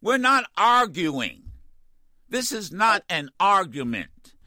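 ordinary talk

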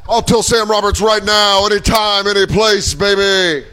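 high, hollered challenge; wrestling promo. Acted. Calling out Sam Roberts to fight, wrestler promo voice. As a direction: bellow it like a pro wrestler.

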